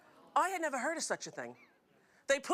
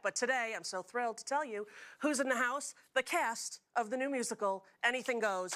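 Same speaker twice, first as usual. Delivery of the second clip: usual speaking voice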